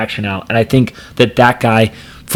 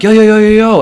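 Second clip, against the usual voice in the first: sharp high yells of yo; repeated warning shouts, high and loud; yelling yo; 11 semitones higher, 4 dB louder than usual. Retold or imitated. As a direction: call out to stop the car. Calling out to speeding driver.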